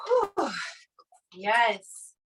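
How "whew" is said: relieved whew. Real, not acted. Exhale after final rep.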